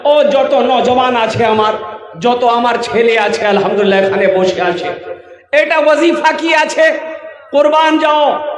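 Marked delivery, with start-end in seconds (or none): 5.5-8.5 preacher shouting a question to the gathering; calling out. Real, not acted. Raising his voice at the crowd.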